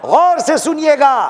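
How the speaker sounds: high, rousing challenge to the congregation; impassioned preaching. Acted. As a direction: demand their attention from the pulpit. Preaches at full force.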